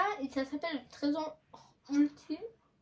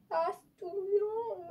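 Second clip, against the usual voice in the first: squeamish cry; 6 semitones higher, slower than usual. Real, not acted. Touching slime, disgusted cry.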